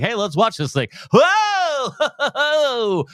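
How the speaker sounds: high, hammed-up fake excited reactor; mock fake excitement. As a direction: ham up a phony thrilled reaction. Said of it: "They're right there just going like".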